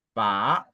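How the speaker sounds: "pa" rising from low; second tone. Shown as a demonstration. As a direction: upward sweep on "pa". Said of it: "le deuxième ton".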